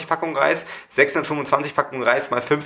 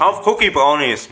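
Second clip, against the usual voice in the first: said again louder and slower; louder repeat; 3 semitones higher, 5 dB louder than usual. Real, not acted. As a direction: repeat it clearly so they hear.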